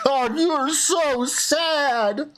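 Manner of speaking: high, slow mock pity for a sad kid. Host pities the caller, high mock pity. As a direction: coo over him like a pitiful child.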